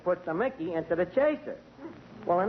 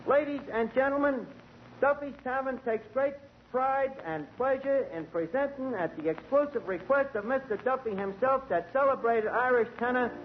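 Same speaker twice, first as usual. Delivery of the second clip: grand, higher announcer voice; hammed-up stage introduction; announcer intro; 5 semitones higher than usual. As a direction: present the tenor like a ringmaster. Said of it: "Give me that microphone".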